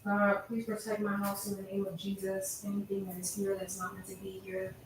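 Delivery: soft murmured prayer; hushed cleansing prayer; quiet prayer. Real, not acted. Prays over her apartment.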